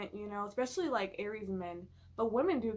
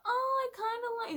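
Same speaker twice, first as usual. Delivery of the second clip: wishy-washy; 13 semitones higher, 3 dB louder than usual. Pretend. Mocking wishy-washy opinion.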